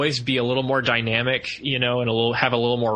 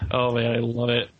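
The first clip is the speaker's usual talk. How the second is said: ordinary delivery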